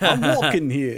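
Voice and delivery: exaggerated New York tough guy; hammed-up Noo Yawk street voice; New York accent. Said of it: "someone doing a New York accent".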